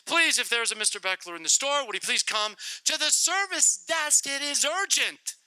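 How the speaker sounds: announcer getting urgent. Retold or imitated. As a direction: press it like an urgent store page. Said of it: "I heard it again".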